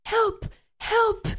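very high, loud cries for help; shouting again. A narrator's reading. Boy shouts for help again, very high shout. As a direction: scream it out. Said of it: "he shouted again".

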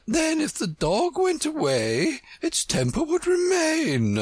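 very high queenly exclamation; triumphant. A narrator's reading. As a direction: clinch the argument in a high, victorious voice. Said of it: "exclaimed triumphantly".

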